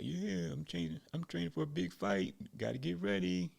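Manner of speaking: a hint of an eager gym hopeful; lightly voiced eager fighter. Gym regular tells Sabata about his big fight, lightly voiced eagerness.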